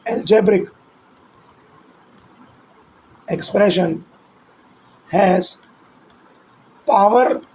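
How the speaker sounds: each word spoken slowly with long gaps for writing; measured, deliberate note-taking dictation. Shown as a demonstration. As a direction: dictate it word by word so students can copy. Teacher dictates a definition for notes.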